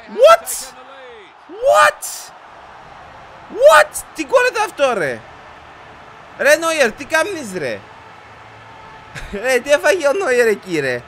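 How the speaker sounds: very high, loud shouts of "what"; incredulous high-pitched outburst; shocked disbelief. Real, not acted. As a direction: yell in real astonishment at the blunder. Stunned by goalkeeper's blunder.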